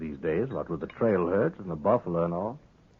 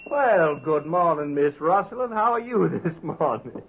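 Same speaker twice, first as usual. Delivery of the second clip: mock-gallant greeting; 9 semitones higher than usual. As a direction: lay the gallantry on thick to needle the Marshal. Webb's mocking greeting to Kitty.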